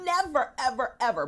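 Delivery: Never hit high and hard, ever, ever trailing down; stress on NEVER. Shown as a demonstration. Host showing listeners her emphasis on never. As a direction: punch Never way up, let the evers fall. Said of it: "y'all hear how I put the emphasis on the ne".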